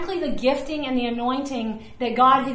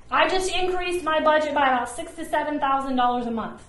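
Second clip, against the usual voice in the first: normal talking voice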